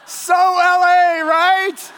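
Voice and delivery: drawn-out, of course it's LA; knowing punchline. Real, not acted. Punchline about actors rehearsing.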